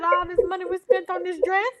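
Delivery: sassy mama. Retold or imitated. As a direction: mom ordering her daughter out. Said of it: "She said".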